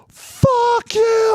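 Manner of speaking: high-pitched, drawn-out furious yell; retold yelling. First sergeant to chow-line server over fish lasagna, yelling. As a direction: scream it out as the outraged sergeant you were. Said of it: "starts yelling like that".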